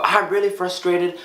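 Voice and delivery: high, slow mock frustration; hammed-up frustrated complaint; acting upset. Shown as a demonstration. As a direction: whine out the frustration. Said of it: "rather than saying".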